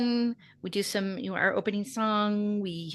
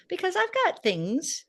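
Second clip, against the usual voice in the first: ordinary talk, nothing marked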